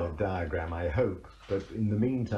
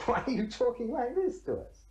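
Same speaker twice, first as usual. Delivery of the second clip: very high, mock-appalled voice; imitated appalled protest. Indian graduates answering a quoted sage, mock appalled. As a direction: put on their appalled disbelief, high-pitched. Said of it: "they're quite appalled".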